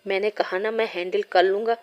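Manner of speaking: ordinary delivery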